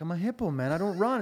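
hint of a stoned hippo; lazy hippo. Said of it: "the hippopotamus is like".

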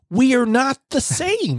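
very high, slow, exaggerated; dramatic declaration. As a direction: overplay it like a meme.

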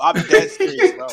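cracking up mid-sentence; laughing. Real, not acted. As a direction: try to stay serious but laugh. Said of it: "say it without laughing".

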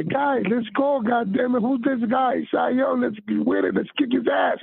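high-pitched retold dugout cheering; cheering teammates on. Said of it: "I keep going by".

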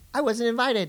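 talking while thumping the desk; banging the desk. Real, not acted. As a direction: knock on the desk while speaking. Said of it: "Stop banging the desk".

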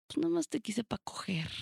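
hushed, breathy retelling of his cruel message; quoted ex in a whisper; whispered quote. Retold or imitated. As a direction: whisper it like a confession. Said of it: "me dijo como de que".

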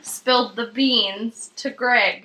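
slow, each word carefully enunciated; over enunciating. Acted. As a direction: spell out every word slowly. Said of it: "Stop talking like that".

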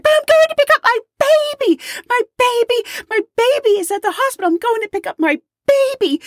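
very high, squealing mock desperation; frantic mother. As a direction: plead hysterically, as if the plane door is shut. Acted mother pleading at closed door.

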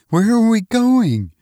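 high-pitched, worried woman's voice; bewildered wife. Acted. Wife asks husband about their sudden flight, bewildered and high.

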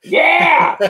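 high, very loud cheer; excited shout. Real, not acted. Co-host cheering the guest on, excited shout. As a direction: whoop it out at full volume.

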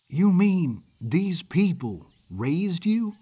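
incredulous, halting question. Acted. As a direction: draw it out in disbelief.

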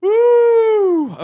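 high, loud whoop. Real, not acted. Host whooping after the laughing fit, loud. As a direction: let out a big cheer.

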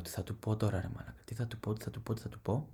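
just woken, quietly panicking about dad; panicked mutter. Retold or imitated. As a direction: fret under your breath in a panic.

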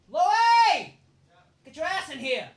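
high, loud shout to call him in; yelling for Louie. Acted. Calling Louie into the room, loud yell. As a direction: bellow across the house for him.

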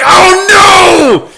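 high, loud horrified shout; realizing shout. Retold or imitated. Davon realizing the guy was hitting on him, loud horrified shout. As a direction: shout it as the truth hits. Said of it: "I'm like".

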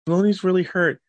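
put-on high-pitched child reporting trouble; imitated worried son. Son telling dad sister is hurt, high imitated worry.

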